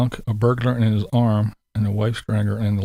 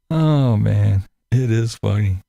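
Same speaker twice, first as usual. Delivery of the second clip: ordinary delivery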